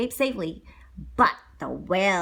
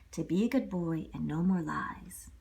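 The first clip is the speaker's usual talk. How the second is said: whispering fairy; 6 semitones lower, 7 dB quieter than usual. A narrator's reading.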